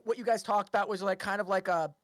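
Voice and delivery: normal talking voice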